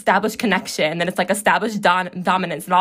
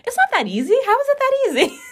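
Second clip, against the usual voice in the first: high, disbelieving squeak; much higher, amazed at how easy they make it look; incredulous inner voice; 3 dB louder than usual. Retold or imitated. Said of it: "being like".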